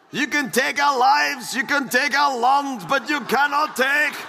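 high, rousing Scottish rallying shout; Scottish battle cry. Acted. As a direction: build to a long, held Scottish yell on the last word. Mock Scottish battle cry after accent reading.

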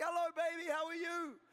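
high, cheery imitation of a wife; mock-cheerful spouse greeting; happy wife. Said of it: "she's happy".

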